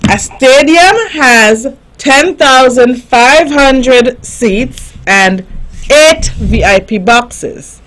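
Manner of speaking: slow, careful reading pace; reading slowly. Shown as a demonstration. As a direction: read it out slowly, word by word. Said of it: "I'm going to read it slowly".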